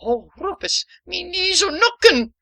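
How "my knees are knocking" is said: high, swooning Irish brogue saying "nookin'". Acted. Katie swoons in brogue over the wedding news. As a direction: swoon in a thick Irish lilt. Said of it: "another saying from Killarney?".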